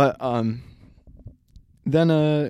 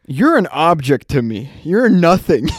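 loud, slow, cold declaration; cold dramatic voice; 3 semitones higher, 8 dB louder, slower than usual. Retold or imitated. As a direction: deliver it like a cold villain. Quoting friend's cold drunk line.

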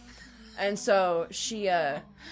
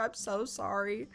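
softer, sheepish retold apology; retold remorse; 7 dB quieter than usual. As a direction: say it small and sorry, imitating him. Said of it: "Arlen felt so bad".

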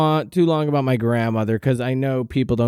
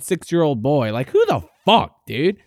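normal talking voice

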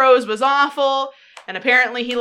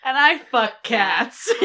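slow, deadpan machine voice; robotic mock declaration; slower than usual. Friend joking about cats, slow deadpan machine voice. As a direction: announce it like a droning robot.

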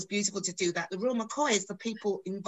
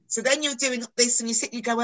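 usual speaking voice

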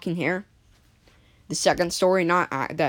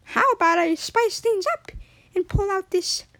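goofy voice; 13 semitones higher than usual. Retold or imitated. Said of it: "little Timmy was feeling a little goofy".

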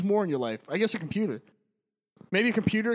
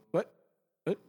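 ordinary talk, nothing marked

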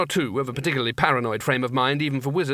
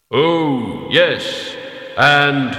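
loud, slow, booming order; heavy leaden voice; 7 dB louder, much slower than usual. A narrator's reading. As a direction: boom it out like lead striking rock. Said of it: "hitting a rock with a roll of sheet lead".

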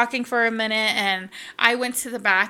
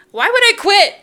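high, loud rhetorical outburst; emphatic disbelief; 10 semitones higher, 10 dB louder than usual. Retold or imitated. Stylist asks herself about leaving the job, emphatic disbelief. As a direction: throw the question up high and loud.

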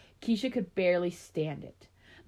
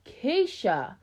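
sharp, higher-pitched scolding of a name; warning call; 6 semitones higher, 4 dB louder than usual. A narrator's reading. Mother warns her elder daughter in one word, scolding. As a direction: warn her with just her name. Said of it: "got the message in that one word".

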